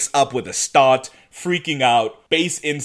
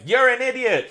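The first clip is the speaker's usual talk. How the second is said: higher pitched jab; mock insult; 10 semitones higher than usual. Damon in the background calling Elena an idiot. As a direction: toss the insult from the back.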